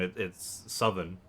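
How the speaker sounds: ordinary talk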